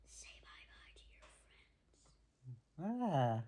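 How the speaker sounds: whispered, then a low final word; menacing whisper. Pretend. Son playing an assassin, threatening his dad's character in a menacing whisper. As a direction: whisper like a game assassin closing in.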